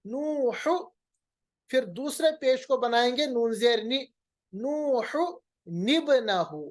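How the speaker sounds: नूहु निब्नहु with a linking ni; nun qutni reading. Shown as a demonstration. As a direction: recite नूहु निब्नहु as Quran. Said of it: "मगर क्या पढ़ेंगे".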